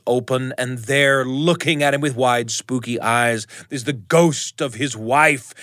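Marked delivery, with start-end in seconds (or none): none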